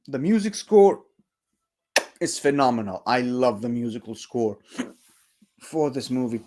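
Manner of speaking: talking through hiccups; speaking between small hiccups. Real, not acted. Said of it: "Sorry, I'm hiccuping a bit".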